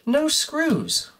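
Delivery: jumps way up in pitch at the discovery; an exasperated yelp of disbelief; surprised. Real, not acted. Tinkerer finding no screws in his stereo, high surprised yelp.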